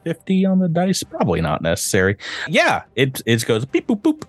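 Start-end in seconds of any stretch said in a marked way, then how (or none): 3.3-4.2 playful machine beeps; beep boop noises. Pretend. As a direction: chirp the beeps, last one high. GM mimics computer panel noise.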